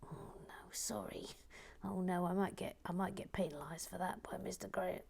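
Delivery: a mock-nervous hushed confession; mock worried whisper. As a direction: whisper it like you might get caught. Fears being penalised, hushed aside.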